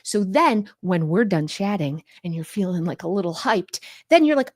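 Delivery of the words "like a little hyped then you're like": usual speaking voice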